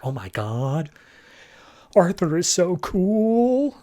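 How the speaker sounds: slow, high starstruck gush; awed onlookers. Retold or imitated. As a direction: swoon over Arthur, drawn out. Crowd awed by Aquaman.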